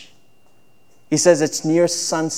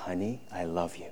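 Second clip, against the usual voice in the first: soft, tender lover's whisper, much quieter; gentle romantic whisper; 10 semitones lower, 17 dB quieter than usual. Shown as a demonstration. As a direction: murmur it softly to your spouse. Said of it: "in a gentle whisper, using your most romantic voice".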